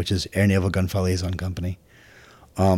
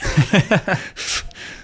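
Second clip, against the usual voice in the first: breathy laugh; 11 semitones higher than usual. Real, not acted. Laughing at his own fate.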